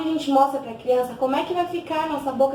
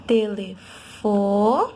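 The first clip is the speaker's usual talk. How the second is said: slow sounding out; 4 semitones lower, much slower than usual. Shown as a demonstration. Sounding out word slowly.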